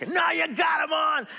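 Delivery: high, loud thrill of the catch; excited shout. Acted. Angler yelling as the fish takes the line, excited shout.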